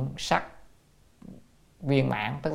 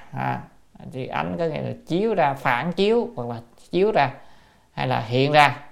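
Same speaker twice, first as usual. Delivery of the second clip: usual speaking voice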